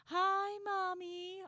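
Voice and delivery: high, drawn-out mock-child singsong; kids greeting mom. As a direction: imitate kids chirping a rehearsed hello. Voicing kids greeting their mother.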